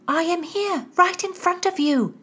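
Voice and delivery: high-pitched urgent reply; anxious girl. Acted. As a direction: answer high, guiding a friend in the dark. Girls grope anxiously in dark passageway.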